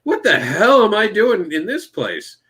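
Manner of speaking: high-pitched, baffled disbelief; incredulous. Retold or imitated. Traveler lost in own country, incredulous. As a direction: ask it as if you can't believe where you are.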